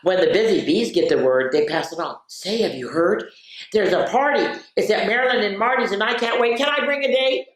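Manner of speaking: bright, lively storybook voice; excited picture-book reading; reading to children. Acted. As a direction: read it like a party is coming.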